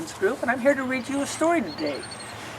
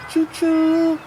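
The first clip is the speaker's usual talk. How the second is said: high, playful choo-choo; train whistle; 8 semitones higher, 5 dB louder than usual. Acted. Reader imitating the lonely train whistle for children, high and playful. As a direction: mimic the train for the kids.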